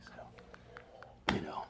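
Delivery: quiet, husky whisper in character; Jason Statham. Acted. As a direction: drop into a near-whispered action-hero impression. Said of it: "I can do a pretty good Jason Statham".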